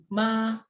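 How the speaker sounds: "Ma" long and level; first tone. Shown as a demonstration. Teacher gives the word for mother, level first tone. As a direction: hold "Ma" evenly with no bend. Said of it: "nada satu, itu artinya ibu".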